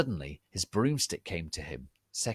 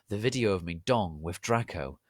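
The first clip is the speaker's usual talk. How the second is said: ordinary delivery, nothing marked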